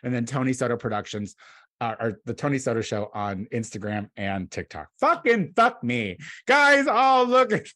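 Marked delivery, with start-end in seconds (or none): 5.0-7.7 louder and higher, fed up; exasperated at his own flub; frustrated outburst. Real, not acted. Frustrated outburst after flubbing plug.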